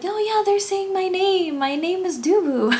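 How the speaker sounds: high-pitched mock insistence; squeaky-high playful protest, imitating her; Dubu's voice. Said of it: "And she's like".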